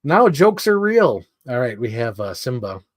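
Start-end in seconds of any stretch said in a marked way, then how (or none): 0.0-1.2 high, loud, slow wail; exasperated disbelief. Retold or imitated. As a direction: wail it in mock despair. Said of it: "And I was like".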